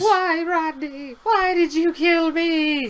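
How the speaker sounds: high, drawn-out mock lament; mock tragic wail. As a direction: wail it slowly in hammed-up anguish. Acts out a killed Pokémon's lament.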